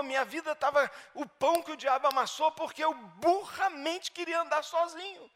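loud, high-pitched preaching; preaching at full force. Acted. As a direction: preach it out hard to the congregation.

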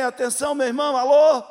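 loud, high call for attention; loud mock wife calling alô. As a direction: snap him out of it, loudly.

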